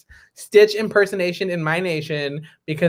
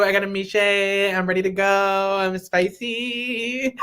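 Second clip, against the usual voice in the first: slow, drawn-out put-on voice of a friend; impression of co-host; 2 semitones higher, 4 dB quieter, slower than usual. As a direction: drag it out, teasing her voice. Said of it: "This is you".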